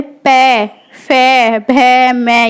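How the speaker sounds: "पै, फै, भै, मै" recited low and even, made with the lips; low teacher's drill of "पै, फै, भै, मै" on the lips; lip-sound demonstration. Shown as a demonstration. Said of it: "होठ हमारे राउंड राउंड हो जाते हैं".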